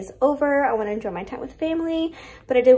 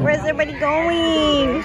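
ordinary delivery

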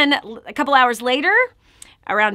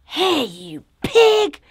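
angry insult; 5 semitones higher, much slower than usual. Retold or imitated. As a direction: spit the insult at the debtor. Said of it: "he's like".

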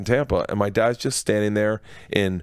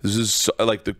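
ordinary talk, nothing marked

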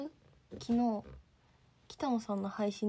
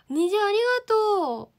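excited thanks; 10 semitones higher, 12 dB louder than usual. Real, not acted. Thanking for a rainbow gift.

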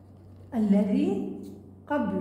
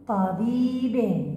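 slow model word; much slower than usual. Shown as a demonstration. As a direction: present طبيب slowly to the class. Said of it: "عندنا كلمة".

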